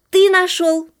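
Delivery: high, loud answer in chorus style; loud shout. A narrator's reading. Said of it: "громко закричали мышата". (громко кричит высоким голосом)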